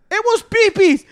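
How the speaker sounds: high, loud shout. Retold or imitated. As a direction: yell it out up high. Kids shouting at night.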